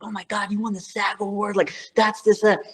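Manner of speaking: high, breathless mock California kid; imitating star-struck California kids; fawning kid. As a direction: gush like an impressed Valley kid. California kids fawning over award.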